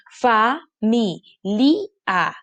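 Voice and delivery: "fa, mi, li, a" as four separate beats; slow syllable-by-syllable split with A on its own; wrongly split syllables. Shown as a demonstration. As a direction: break "familia" slowly into fa, mi, li, a. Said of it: "¿qué hacen? Dicen".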